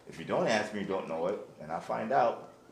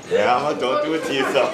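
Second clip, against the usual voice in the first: laughing warning; 4 semitones higher, 10 dB louder than usual. Real, not acted.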